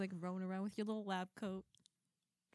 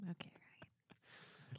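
whispering; 16 dB quieter, much slower than usual. Real, not acted. Hushed reply at the end of the rant.

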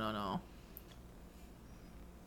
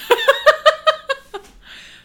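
high burst of laughter; loud laugh; 9 dB louder than usual. Real, not acted.

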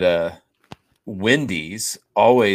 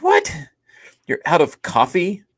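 high incredulous what; disbelief; 11 semitones higher, 5 dB louder than usual. Retold or imitated. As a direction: rise in shocked disbelief. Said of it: "I'm like".

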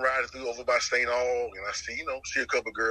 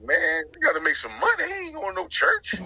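defiant refusal; 4 dB louder than usual. Retold or imitated.

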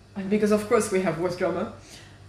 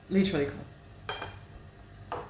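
whispered; 4 semitones lower, 10 dB quieter, much slower than usual. Real, not acted. Friend can't talk, mouth full.

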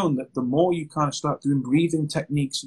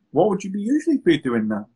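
usual speaking voice

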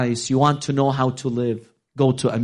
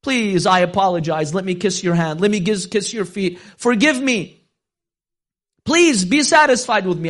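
frightened begging; 7 semitones higher, 3 dB louder than usual. Acted. Seller begs forgiveness, shaking.

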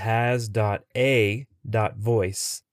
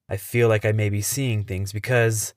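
usual speaking voice

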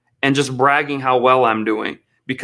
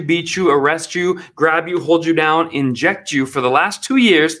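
ordinary talk, nothing marked

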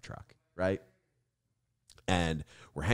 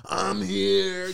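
much higher and louder, announcing himself; mock Ray arriving; 13 semitones higher, 8 dB louder than usual. Teasing Ray about showing up bloody, proud announcement. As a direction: burst in proudly, hammed up. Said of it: "you just show".

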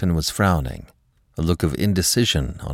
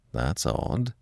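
soft, lowered musing voice; low, to himself; 4 semitones lower, 8 dB quieter than usual. A narrator's reading. Gerald puzzles over his wife's nerves, low voice to himself. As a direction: murmur it as if only to yourself. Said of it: "in a low voice, as though speaking to himself".

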